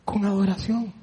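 soft and low, stress on adoración; quiet reverent repeat; hushed again. Acted. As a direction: let adoración land gently. Preacher repeats phrase softly.

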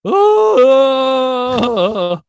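loud, high-pitched drawn-out "ooh"; imitated catchphrase howl. Host mimicking his catchphrase to the audience, loud high howl. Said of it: "He's like, "You're gonna love this guy." He goes".

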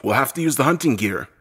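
lightly voiced man proposing a plan; suggesting. A narrator's reading. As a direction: put it forward with some force. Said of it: "Wayne suggests".

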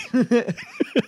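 a quick real chuckle; high laugh. Real, not acted. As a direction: laugh at the tense exchange. Player laughs.

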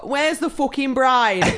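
put-on drunken hen-do northern English, high and drawn out. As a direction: holler it slowly in broad northern English. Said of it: "do you turn to a northern".

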